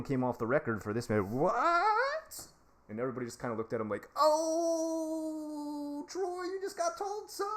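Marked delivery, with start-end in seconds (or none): 1.3-2.5 squeaky, much higher startled yelp; stunned what. Retold or imitated. Troy stunned after being told off. 4.2-7.5 high, teasing put-on voice; mocking onlookers. Coworkers mocking Troy after the scolding.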